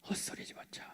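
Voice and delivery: a hushed, hissed retort; whispered curse. Retold or imitated. Preacher whispering Peter's curse at the accusers. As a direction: whisper the curse, almost unvoiced.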